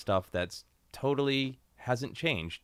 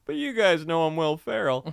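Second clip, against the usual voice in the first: winking comic voice, pitched up; playful wink to the audience; Will Ferrell; 9 semitones higher, 7 dB louder than usual. Retold or imitated. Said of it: "Will Ferrell was kind of effortlessly like".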